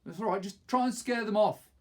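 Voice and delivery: high, loud rallying call; urgent encouragement. Acted. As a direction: push them to act. Aaron urging boys on.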